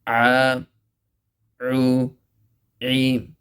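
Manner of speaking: "a, u, e" with a squeezed throat catch; throat-squeezed ain. Shown as a demonstration. Teacher drilling ain from the middle of the throat.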